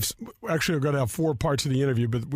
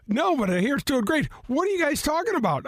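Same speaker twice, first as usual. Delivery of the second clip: lightly raised, eager friend's voice; voicing a friend; 11 semitones higher than usual. Friend calling the host, lightly voiced higher and eager. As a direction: play the caller, a bit higher and keen.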